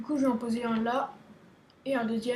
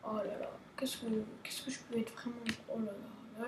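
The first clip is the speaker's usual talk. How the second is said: softer, whispered self-scolding; 3 semitones lower, 14 dB quieter than usual. Real, not acted. Streamer scolding himself for swearing, soft whisper. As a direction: mutter it to yourself ashamed.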